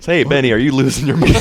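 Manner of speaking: loud, fast, incredulous call. Retold or imitated. Friend passing Benny watering plants in the rain, incredulous call. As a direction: call out quickly over the rain, mock disbelief.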